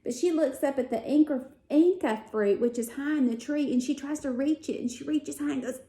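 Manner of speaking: normal talking voice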